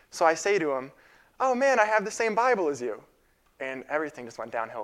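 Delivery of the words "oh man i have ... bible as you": higher, louder, delighted call to a stranger; happy discovery, retold brightly; eager retold greeting. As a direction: imitate your own eager hello.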